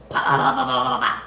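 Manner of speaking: loud mock blaster sounds, pew pew; gun noises. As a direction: blast away with your mouth.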